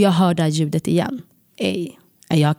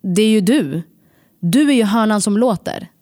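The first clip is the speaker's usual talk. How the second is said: mock shout; 4 semitones higher, 3 dB louder than usual. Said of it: "skriker jag".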